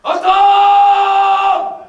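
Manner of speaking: a huge sudden shout, high and loud. A narrator's reading. The wolf bursts into the stable with a shout. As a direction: bellow it out all at once. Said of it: "et il a crié".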